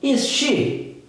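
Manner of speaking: "is she?" high, curious question tune; question intonation. Shown as a demonstration. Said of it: "Dá essa entonação de pergunta". ("is she?" com entonação de pergunta)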